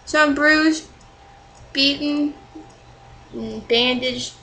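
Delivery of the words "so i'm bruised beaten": normal talking voice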